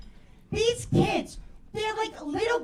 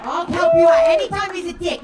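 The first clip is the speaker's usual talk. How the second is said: loud eager buddy; 9 dB louder than usual. Retold or imitated. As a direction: burst in like a pushy pal. Work friends offering help, acted.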